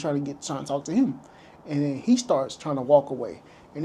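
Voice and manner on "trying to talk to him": usual speaking voice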